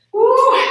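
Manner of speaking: very high, loud whoop; excited whoop. Real, not acted. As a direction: whoop to cheer them on. Coach cheering mid squat.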